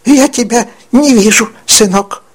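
a crone pretending she can't find him; worried old witch. Old woman searching for invisible Charlie, worried crone call.

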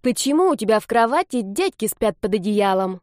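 lightly voiced inquisitive boy; a child demanding an explanation; little brother. A narrator's reading. Brother stares at his sister, puzzled boyish question. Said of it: "уставился на неё братик".

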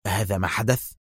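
ordinary delivery

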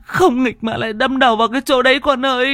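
put-on high, keening wail for a lost child; wailing mother. Mother wails over her missing son, high crying. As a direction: cry it out like a mother in despair. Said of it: "Bà vừa nói, vừa bật khóc".

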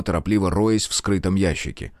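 ordinary delivery

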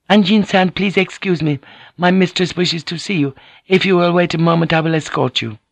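high-pitched, courteous servant voice; lifted, deferential female voice; polite maid. Acted. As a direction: play a maid respectfully passing on a message. Maid delivers a message.

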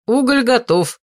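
put-on high young man's voice; announcing. A narrator's reading. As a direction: call it out to the room. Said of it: "возвестил Осов".

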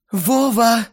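high, loud delighted squeal; excited cry. A narrator's reading. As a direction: squeal his name happily. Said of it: "выдохнула мне в ухо Лена".

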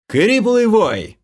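loud, slow, high battle cry; shouting. A narrator's reading. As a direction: belt out the skill name. Calling out skill name.

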